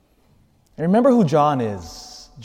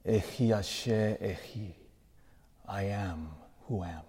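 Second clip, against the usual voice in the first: soft, awed divine name; hushed reverent; 3 semitones lower, 10 dB quieter than usual. Real, not acted. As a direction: say it almost under breath.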